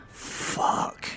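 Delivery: whispered, awestruck swear; awed whisper. A narrator's reading. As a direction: breathe it out in a whisper of awe. Narrator awestruck at her movement.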